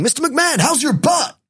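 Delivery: high-pitched yell of a reporter; high, hammed-up heckling call; mock press yelling. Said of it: "they're all yelling".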